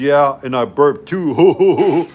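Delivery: put-on slow, dazed drunk-buddy voice; slow dopey friend. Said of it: "and then he goes".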